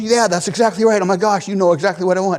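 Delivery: high, fast, gushing delight; thrilled customer. Retold or imitated. Woman customer thrilled at the salesman, fast gushing. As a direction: gush like she was finally understood.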